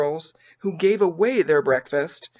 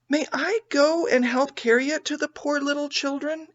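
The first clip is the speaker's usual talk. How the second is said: put-on higher, gentle little girl asking; lightly voiced tender girl; 10 semitones higher than usual. A narrator's reading. Beth asking mother to help carry food. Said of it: "asked Beth".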